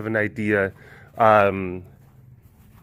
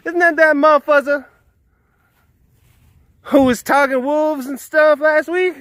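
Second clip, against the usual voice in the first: high-pitched put-on voice of a townsperson; hammed-up villager gossiping about the boy; townspeople voice; 14 semitones higher, 4 dB louder than usual. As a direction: scoff in a high, silly character voice. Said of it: "people in the town were just like".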